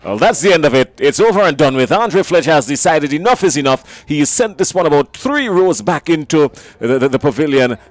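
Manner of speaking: loud, thrilled match-winning call; excited commentary. Real, not acted. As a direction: shout the winning six with delight. Winning six struck, commentator erupts.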